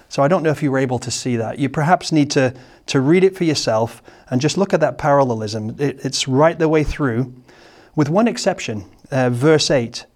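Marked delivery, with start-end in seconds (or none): none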